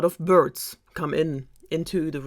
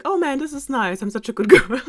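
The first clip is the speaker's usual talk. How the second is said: higher, mock-gushing self-praise; pleased with herself, pitched up, breaking into a laugh; pleased Cinderella; 9 semitones higher, 7 dB louder, faster than usual. Cinderella delighted by her magic tree, mock-gushing.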